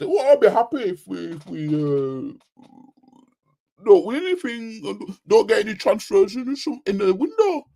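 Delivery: high-pitched mock fan voice; mocking imitation. Imitating a fan content with no transfers, mocking. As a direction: mimic him in a high, halting whine.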